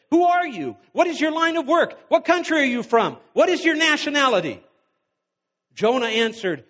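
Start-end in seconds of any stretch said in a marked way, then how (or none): 0.1-4.6 shouted, much higher questions, rushing at the end; loud demanding. A narrator's reading. Panicked sailors interrogating Jonah in the storm, loud and urgent. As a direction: fire the questions at him. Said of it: "they demanded".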